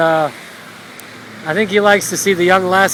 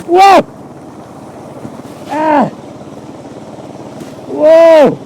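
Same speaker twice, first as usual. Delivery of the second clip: excited whoa; 5 semitones higher, much slower than usual. Real, not acted. Bike plowing through water.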